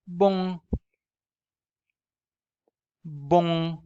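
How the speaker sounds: "bom" with a strongly nasal o. Shown as a demonstration. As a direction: push "bom" through the nose. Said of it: "Very nasal".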